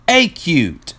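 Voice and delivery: Acute said loud and high, stressing the a; firm. Acted. Euclid insists firmly on the word Acute. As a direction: put the weight on Acute. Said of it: "Euclid said firmly".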